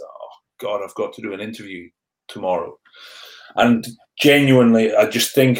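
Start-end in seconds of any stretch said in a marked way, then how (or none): 0.0-2.8 quiet, low, resigned self-talk; dreading inner thought. Retold or imitated. Rugby player recalling his dread of a TV interview, quiet dread. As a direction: murmur it to yourself with dread.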